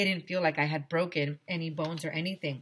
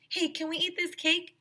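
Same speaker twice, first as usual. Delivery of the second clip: put-on high, eager kid voice; high excited boy; 13 semitones higher than usual. A narrator's reading. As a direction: ask hopefully like a hungry kid. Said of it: "asked Ryan".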